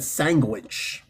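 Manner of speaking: "sandwich" slowly as SANG-gwitch. Retold or imitated. Retelling his friend's thick accent, sandwich as SANG-gwitch. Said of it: "Thick, thick accent".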